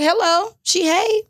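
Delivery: high, sugary fake-polite hello; imitating a forced friendly greeting; mock sweet greeting. Girlfriend greets the woman on the couch, high mock-sweet.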